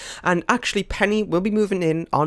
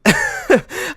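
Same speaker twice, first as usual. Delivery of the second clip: nervous laugh; 5 dB louder than usual. Real, not acted. Shocked by unplanned event.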